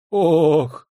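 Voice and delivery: high, loud moaned "oh"; anguished moan; pained groan. A narrator's reading. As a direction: groan it out in dismay. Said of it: "простонал Масолов".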